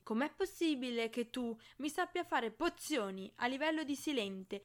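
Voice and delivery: loud, high, angry scolding; angry tirade. A narrator's reading. Hermione yelling at Draco.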